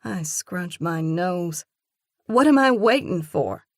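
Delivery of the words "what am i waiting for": higher, louder outburst; sudden frustrated blurt, louder and higher. A narrator's reading. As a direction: let it burst out of you. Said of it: "I blurt".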